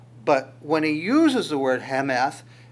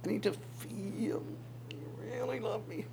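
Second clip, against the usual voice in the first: a soft, breathy plea, much softer, rising high; soft pleading; 8 semitones higher, 13 dB quieter than usual. Retold or imitated. Girl pleading with her fiance, softly. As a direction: murmur it like a fiancee begging to be reassured. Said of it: "what she's asking for is".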